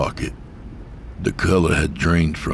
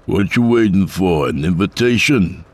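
louder, higher, sarcastic challenge; raised-voice irritated jibe; impatient driver; 4 semitones higher, 5 dB louder than usual. A narrator's reading. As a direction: call out impatiently to someone outside. Driver calls to figure outside bus.

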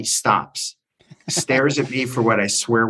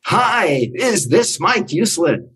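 high, drawn-out showman's greeting; imitated larger-than-life enthusiasm; exuberant male voice; 7 semitones higher, much slower than usual. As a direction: boom out a delighted phone hello. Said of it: "this exuberant male voice".

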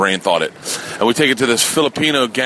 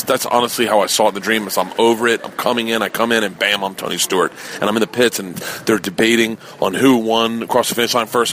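usual speaking voice

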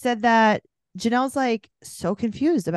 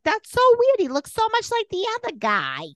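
much higher, louder mock Babs voice, squealing it out; imitating Babs; 3 dB louder than usual. Podcaster mimicking grandma Babs on the show, high mock squeal. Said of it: "Babs is like".

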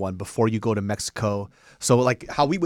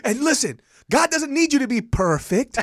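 high, raised-voice sermon punchline; mock preacher; 4 dB louder than usual. Preacher reassuring the flock, high-pitched mock proclamation. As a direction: proclaim the pun like gospel.

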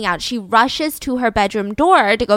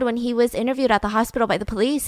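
ordinary talk, nothing marked

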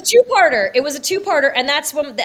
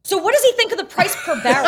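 imitating through laughter; 7 semitones higher, 3 dB louder than usual. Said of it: "And you were like".

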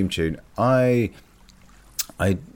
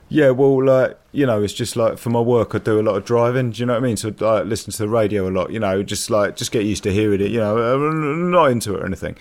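higher, louder flustered bloke making excuses; sheepish geezer; 4 semitones higher, 5 dB louder than usual. Retold or imitated. Flatmate caught listening to the Archers, flustered and sheepish. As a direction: play the caught-out lad, embarrassed and defensive. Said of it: "he got all sheepish and was like".

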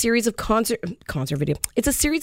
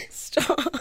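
laughing; 3 semitones lower, 7 dB quieter than usual. Real, not acted. Laughing at a joke.

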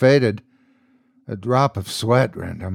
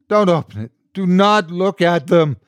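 higher, louder forceful warning hiss; urgent hiss; 7 semitones higher, 6 dB louder than usual. A narrator's reading. Man warns priest not to look outside, hissed warning. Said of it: "the man hissed".

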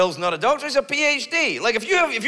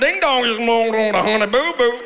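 lightly drawled Southern mimicry; a hint of Honey Boo Boo's Southern twang; mock Southern accent; 3 semitones higher, 5 dB louder than usual. Comic imitates reality TV family, light Southern accent. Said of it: "people have a hard time with my accent?".

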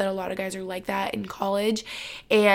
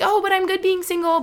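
said much higher, a light sing-song denial; mock breezy insistence; 10 semitones higher than usual. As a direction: brush it off in a high voice. Imitating her past single self.

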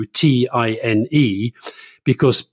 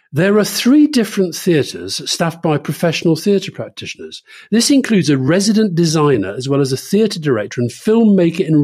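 higher, declaimed reading of a brochure; mock website read-out; 4 semitones higher than usual. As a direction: read it out pointedly, like a boastful prospectus. Reads website aloud mockingly.

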